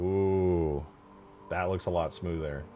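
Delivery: ordinary talk, nothing marked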